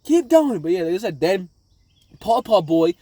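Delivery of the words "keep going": high, loud urgent command; captain's order. Retold or imitated. Luffy ordering his crew to flee, high urgent yell. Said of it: "He's like".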